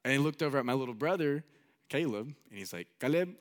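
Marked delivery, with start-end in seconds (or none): 3.0-3.4 higher-pitched imitated Mexican accent; Mexican-accented grandpa asking nicely; grandpa's Mexican accent. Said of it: "he had an accent".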